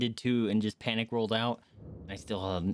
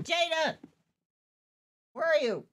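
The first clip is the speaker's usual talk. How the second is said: calling out; 3 dB louder than usual. Real, not acted. Calling out to someone.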